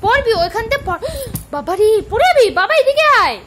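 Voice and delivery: high, loud startled cry of warning; alarmed warning shout. Real, not acted. Child about to fall, mother alarmed. (পড়ে যাওয়ার ভয়ে চমকে উঠে জোরে সাবধান করা)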